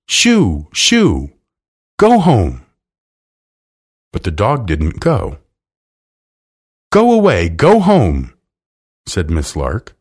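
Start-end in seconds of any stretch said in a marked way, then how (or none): 0.1-2.7 mildly loud, emphatic shooing. A narrator's reading. Miss Lark to the terrible dog, shooing it off. Woman chasing off stray dog. 6.9-8.3 high, loud command to a dog; ordering away. A narrator's reading. Miss Lark to the dog that won't go, ordering it away.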